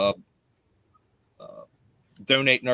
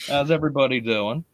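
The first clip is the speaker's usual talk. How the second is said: usual speaking voice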